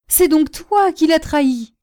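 high, loud outburst of fury; enraged accusation. A narrator's reading. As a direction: lash out at the traitor like a lioness. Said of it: "qui poussa un rugissement de lionne". (accusation furieuse, aiguë et forte)

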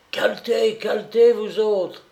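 high, loud repeated warning cry; frantic shouting. A narrator's reading. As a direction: yell it out like a man possessed. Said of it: "criait comme un possédé".

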